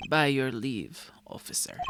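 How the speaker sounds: slow, low, courtly request; low velvety politeness; suave charmer. Acted. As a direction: smoothly pull rank with charm. Gavin charms the policeman.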